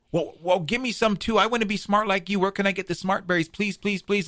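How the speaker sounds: high-pitched, eager pleading; begging Badger. Badger begs Coyote for smart berries, high and pleading. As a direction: plead like an over-keen little animal. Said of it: "Badger starts to beg".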